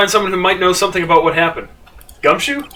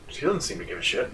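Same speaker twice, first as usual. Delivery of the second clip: much softer, low under-breath remark; muttered aside; 7 semitones lower, 15 dB quieter than usual. Real, not acted. Player commenting on Regina to viewers, soft aside.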